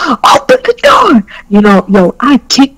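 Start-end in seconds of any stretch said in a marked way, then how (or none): 0.1-1.2 very high-pitched demand; retold angry yell. Woman at the locked bathroom door, retold angry yell. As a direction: re-enact yelling through a locked door.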